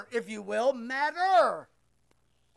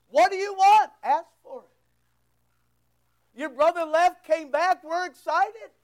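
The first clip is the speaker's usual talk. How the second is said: loud, high, excited shouting dad; father shouting to the angry older son, thrilled; overjoyed father; 4 semitones higher, 4 dB louder than usual. Acted. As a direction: pour out a father's joy at his son. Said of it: "he lays it on him".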